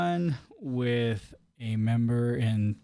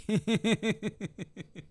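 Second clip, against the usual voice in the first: high laugh; 8 semitones higher than usual. Real, not acted. Laughing at the challenge.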